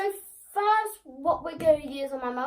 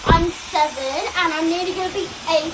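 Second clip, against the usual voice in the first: raised voice over the noise; over hair dryer; 5 semitones higher, 7 dB louder than usual. Real, not acted. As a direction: talk loudly over the dryer. Child answering over hair dryer.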